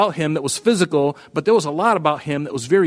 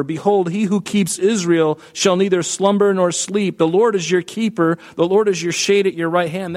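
fervent scripture reading; 4 semitones higher than usual. Acted. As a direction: proclaim the psalm, higher and ringing. Reads Psalm 121 aloud.

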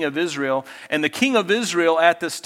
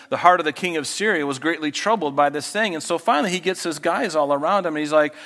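ordinary delivery

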